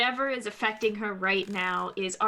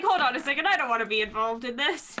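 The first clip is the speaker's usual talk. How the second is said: higher-pitched, amused objection; mock protest; 4 semitones higher, 5 dB louder, faster than usual. Player voicing the ram caught in the attack, mock protesting. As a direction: playfully voice a reluctant bystander backing out. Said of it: "It's just sort of like".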